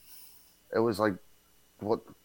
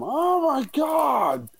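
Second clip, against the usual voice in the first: very high, slow drawn-out wail; imitated anguished wail; 5 dB louder, much slower than usual. Mother seeing the tower hit, imitated long high wail. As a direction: stretch it into a long stricken cry. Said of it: "you hear the upsetness in her voice".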